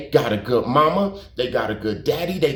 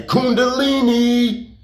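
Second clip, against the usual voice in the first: high, slow, sustained holler; drawn-out call; 8 semitones higher, slower than usual. Retold or imitated. Calling down to Country on his scooter, high drawn-out call. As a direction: imitate hailing someone from a balcony, stretching it out. Calls from balcony to passing rider.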